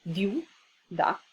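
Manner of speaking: Viu, then a pause, then da, each syllable apart; split into syllables. Shown as a demonstration. Teacher showing students the syllable split, slow and separated. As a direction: break Viu and da cleanly apart. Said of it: "Se separa en sílabas de esta manera".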